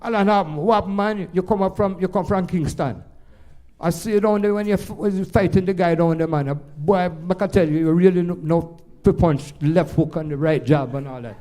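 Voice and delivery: put-on high, excited Jamaican accent; a fan greeting a boxer in a Jamaican accent. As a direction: gush at the fighter in broad Jamaican. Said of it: "excuse my Jamaican accent".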